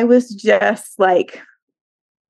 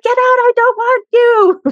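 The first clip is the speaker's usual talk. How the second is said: high-pitched imitation of a kid's outburst; not so nice; 3 dB louder than usual. Upset kid telling mom to get out, high mock outburst. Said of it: "Sometimes it's not as nice".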